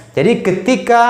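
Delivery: normal talking voice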